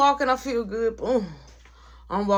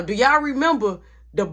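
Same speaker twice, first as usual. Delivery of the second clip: ordinary talk, nothing marked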